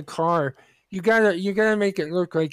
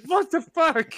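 startled high exclamation; shocked; 9 semitones higher, slower than usual. Retold or imitated. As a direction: react in disbelief at your friend. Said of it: "And I'm like".

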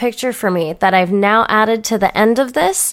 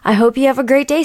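normal talking voice

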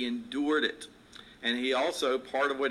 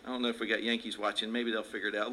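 normal talking voice

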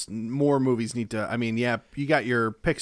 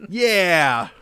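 gung-ho cheering; 5 semitones higher, 5 dB louder, slower than usual. Pretend. Egging on meanness.

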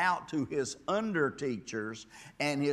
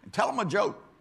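loud, high snap at the moaners; preacher yelling to lighten up; shouted rebuke; 9 semitones higher, 13 dB louder than usual. Acted. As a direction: cut them off with a shout. Preacher shouts at gloomy crowd.

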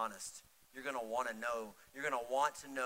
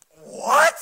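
high, loud yelp of disbelief; mock shocked outburst; 21 dB louder than usual. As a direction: burst out in exaggerated indignation. Retold shock at wife's reply.

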